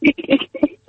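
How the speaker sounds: caller laughing uncontrollably; helpless laughter. Real, not acted. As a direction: burst out laughing. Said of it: "I can't stop laughing".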